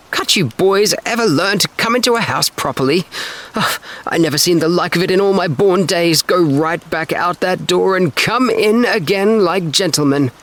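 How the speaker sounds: high, fussy scolding, slowing at the end; scolding aunt. A narrator's reading. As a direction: nag the boys like a prim old aunt. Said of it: "she exclaimed".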